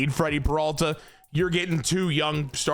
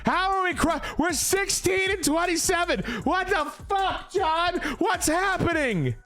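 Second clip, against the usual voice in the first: exasperated disbelief; 12 semitones higher than usual. Real, not acted. GM venting at his slumping team, high exasperated disbelief. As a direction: throw your hands up at the losing record.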